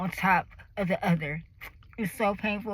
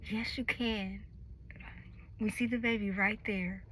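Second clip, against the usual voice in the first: compassionate nurse; 9 dB quieter than usual. Retold or imitated. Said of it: "a compassionate, wonderful nurse".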